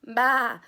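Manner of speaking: a loud bleating sheep; hammed-up farmyard baa. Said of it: "said the sheep".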